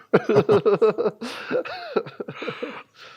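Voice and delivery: a high, long burst of real laughter. Real, not acted. Laughing at his own joke about skipping Barbie, real laughter. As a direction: crack up at your own joke.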